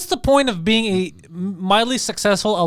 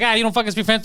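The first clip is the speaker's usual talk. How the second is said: ordinary delivery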